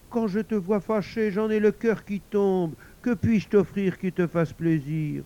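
high, loud, anxious coaxing; pleading husband. Acted. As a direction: beg to please her. Husband pleads with upset wife.

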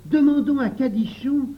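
put-on high, loud boyish cry; loud, high-pitched exclamation; exclaiming. A narrator's reading. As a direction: cry out the idea to the whole group. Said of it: "s'écria Jacques".

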